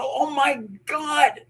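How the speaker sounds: high, drawn-out amazed gasp of words; retold astonishment. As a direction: stretch it out, stunned. Retelling shock at ghostly reply.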